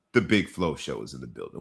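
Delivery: deep, low announcer voice; reverent bass intro. Acted. Host introducing his cohost by name with bass in his voice. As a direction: drop low and boom the name. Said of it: "Say it with some reverence in your voice".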